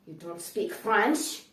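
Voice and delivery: slow, loud disbelief; incredulous clerk. Retold or imitated. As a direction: drag it out like you can't believe it. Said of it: "And they're like".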